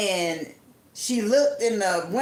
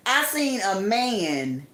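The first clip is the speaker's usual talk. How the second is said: ordinary talk, nothing marked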